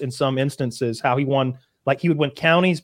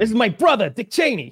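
sitcom Frank; 8 semitones higher than usual. Acted. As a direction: introduce your brother in a hammed-up sitcom voice. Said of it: "He could step in for Frank".

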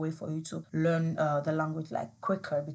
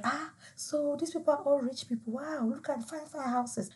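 high, hushed amazement; awed inner voice; 7 semitones higher, 6 dB quieter than usual. Retold or imitated. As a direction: gape at the fine houses in wonder. Awed thoughts on first seeing rich houses.

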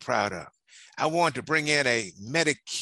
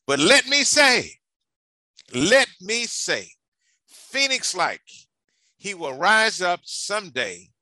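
slow, louder and higher, proclaiming from the House floor; oratorical quote; 6 semitones higher, 6 dB louder, slower than usual. Retold or imitated. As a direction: declaim it like a farewell speech to Congress. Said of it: "He said the following".

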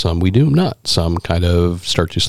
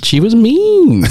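much higher, louder and slow, stretching out mean; emphatic mock outrage; 5 dB louder, much slower than usual. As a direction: drag out the verdict, scandalized. Echoing the cruel character.

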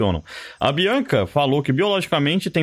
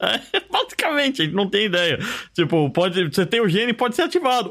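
laughing; 9 semitones higher than usual. Real, not acted. Laughing fit during podcast.

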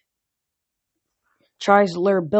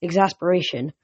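plain reading voice

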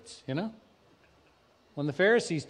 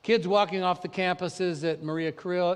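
usual speaking voice

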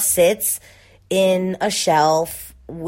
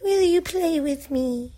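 creepy doll voice; 10 semitones higher than usual. Pretend. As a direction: speak as a too calm creepy doll. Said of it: "Is that what his voice sounds like?".

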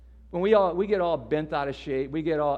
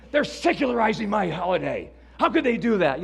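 louder, huffy, imitating an offended churchgoer; mock outrage; 4 semitones higher, 7 dB louder than usual. Mocking Christians angry at a Starbucks cup, hammed-up outrage. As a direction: play up the self-righteous indignation. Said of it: "other Christians that get angry around Christmas time".

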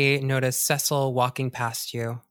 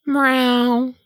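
pitched-up loud cat meow; loud high meow; 11 semitones higher, 8 dB louder than usual. Acted. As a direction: yowl it like a cat. GM voices the cat.

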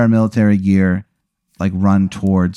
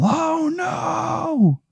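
very high, drawn-out falsetto wail; mock dying yell; much slower than usual. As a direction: wail a slow, hammed-up farewell. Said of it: "you hear him yell".

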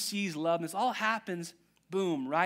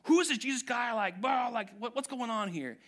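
raised, pushy questioning voice; mock questioning Pharisees; 6 semitones higher than usual. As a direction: badger him with questions. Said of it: "they're like".

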